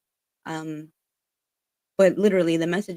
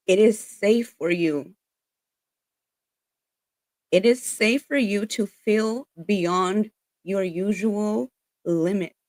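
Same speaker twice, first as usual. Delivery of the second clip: slow reassurance; 4 dB louder, slower than usual. Real, not acted. Reassuring message in the reading.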